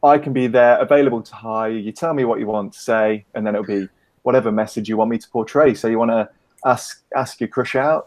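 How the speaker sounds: ordinary delivery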